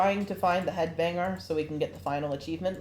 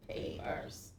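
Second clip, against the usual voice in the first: breathy whisper; 14 semitones lower, 15 dB quieter than usual. Pretend. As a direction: whisper the word softly. Whispering the word.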